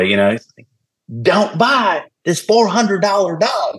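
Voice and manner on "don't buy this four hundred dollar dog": high, slow mock good old boy voice; pitched-up imitation of a country trainer; mimicking the good old boy trainer. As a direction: send up the old-school trainer warning him off. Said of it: "good old boy".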